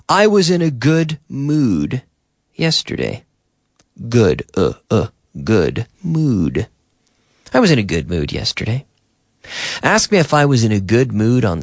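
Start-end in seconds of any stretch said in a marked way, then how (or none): 0.1-3.2 higher, spaced out, pausing before the last word; careful model answer. Shown as a demonstration. Teacher models answer for learners, careful and higher. 7.5-8.8 fast, run-together delivery; quick natural repeat. Shown as a demonstration. Teacher repeats model answer at natural speed, quick and connected.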